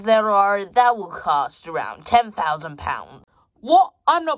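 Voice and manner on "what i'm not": loud, high outburst; shocked. Acted. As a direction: blurt it in shock. Shock at price.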